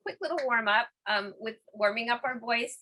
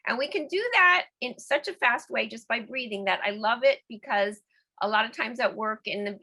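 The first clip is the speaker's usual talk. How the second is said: ordinary talk, nothing marked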